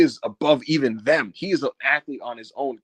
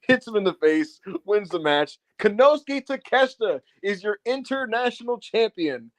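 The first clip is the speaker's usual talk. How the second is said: ordinary delivery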